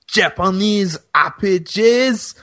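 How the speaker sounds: lightly hammed German voice; a hint of German; mock German accent. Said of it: "You sound German".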